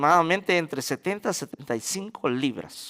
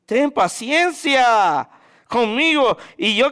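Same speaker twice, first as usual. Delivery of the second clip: slow, higher begging plea; slow, raised pleading; pleading servant; 7 semitones higher, 4 dB louder, slower than usual. Acted. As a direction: beg the master for patience, drawn out. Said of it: "le suplicaba diciendo".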